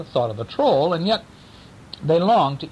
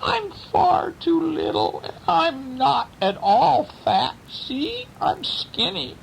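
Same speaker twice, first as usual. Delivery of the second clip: much higher, slow little goat voice; tiny voice; 9 semitones higher, slower than usual. Acted. Littlest goat talks the troll out of eating him, high and tiny. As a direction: stay small and squeaky. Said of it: "in a tiny voice".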